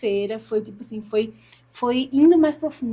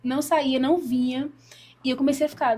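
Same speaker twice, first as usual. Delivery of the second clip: ordinary delivery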